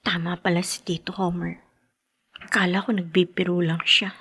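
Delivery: breathy whisper, barely voiced; low, secret whisper to himself; hushed whisper. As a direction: whisper so no one hears you. Son hiding, overhearing at night.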